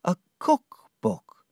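slow, high letdown echo; mildly crestfallen repeat; disappointed. Acted. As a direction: Dora echoing the gift, let down. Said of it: "Dora was less excited now".

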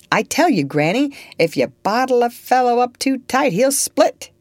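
rising outburst, he can't be bottled up; sick man bursting out at granny, worked up and loud; heated protest. Acted. Patient protests to old lady.

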